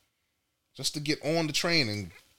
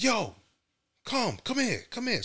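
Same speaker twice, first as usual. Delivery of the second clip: calling wife; 9 semitones higher than usual. Retold or imitated. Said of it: "she's like".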